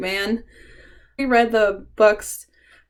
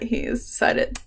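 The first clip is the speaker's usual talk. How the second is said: ordinary talk, nothing marked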